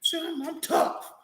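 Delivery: high, slow mock tough boast; mock tough talk. Teasing his partner about acting tough. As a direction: drag out a fake tough brag.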